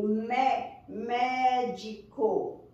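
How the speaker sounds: "médico" slowly split, strong opening MÉ; drawn-out syllables in "médico", weight on MÉ; stress on first syllable. Shown as a demonstration. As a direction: give MÉ first, then sound out "médico". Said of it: "é a primeira sílaba".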